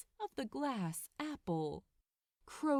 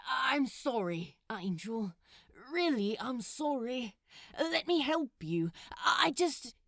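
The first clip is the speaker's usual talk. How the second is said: pleading apology; 2 semitones higher, 4 dB louder than usual. Acted.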